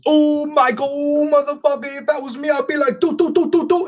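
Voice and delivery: high-pitched mock of a rowdy moviegoer. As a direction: squeak it out like an annoying teen. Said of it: "this bitch goes".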